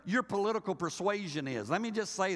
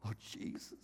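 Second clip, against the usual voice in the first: mock despairing whisper; 13 dB quieter than usual. Mock panic at downsizing news.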